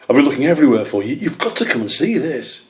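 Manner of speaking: rushed breathless urgency; out of breath. A narrator's reading. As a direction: gasp the words out fast. Badger still panting, urgent.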